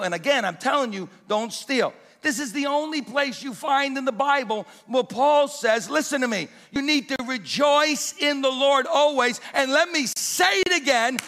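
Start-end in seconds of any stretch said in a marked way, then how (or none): 2.2-11.2 building, louder call to the crowd; full-force preaching. Acted. Preacher rallying the congregation to keep on rejoicing, building to a shout. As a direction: lift the room toward the amen.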